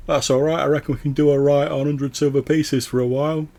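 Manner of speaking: high, loud cheeky heckler; voice from the back. Acted. Bandit at the back calling out to the envoy, high cheeky voice. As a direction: call out from the back of the crowd.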